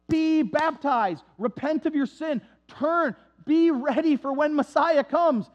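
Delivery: slow, drawn-out preacher's call; a hint of fiery preaching; lightly voiced preacher calling for repentance. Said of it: "He's preaching".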